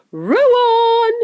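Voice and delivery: high, drawn-out rallying shout; shouted command. A narrator's reading. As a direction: yell to the rowers to press on. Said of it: "shouted Mister Wonka".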